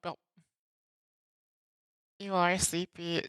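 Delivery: usual speaking voice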